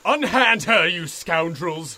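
loud, high swashbuckling challenge; brash old-fashioned hero bravado. Acted. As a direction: bellow it like a storybook hero. Toad charges the gangsters.

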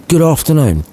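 dismissive. Acted. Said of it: "Scrooge added dismissively".

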